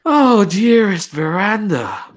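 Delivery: high, loud, theatrical greeting; sharp noblewoman. Acted. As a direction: gush over a friend with bite. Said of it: "she's like".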